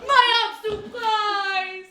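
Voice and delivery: loud and slow, high; pompous poet reciting; declaimed verse. Shown as a demonstration. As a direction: proclaim it grandly. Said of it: "reciting his verse".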